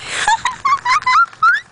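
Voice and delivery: shrill high giggle; high squeal laugh. Real, not acted. Giggling at boredom.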